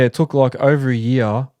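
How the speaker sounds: usual speaking voice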